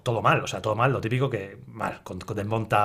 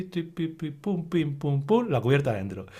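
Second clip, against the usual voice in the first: ordinary talk, nothing marked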